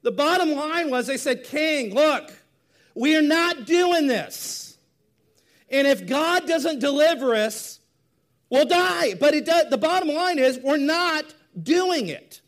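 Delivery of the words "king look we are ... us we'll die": loud, high, emphatic refusal; defiant declaration. Acted. Hebrew teenagers refusing the king's order, loud defiance. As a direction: declare it forcefully, with slow weight on the refusal.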